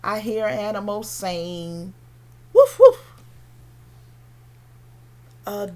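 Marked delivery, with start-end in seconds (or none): 2.5-3.0 very loud, high woofs; loud high-pitched yapping; dog bark. Acted. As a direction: bark sharply twice like a dog.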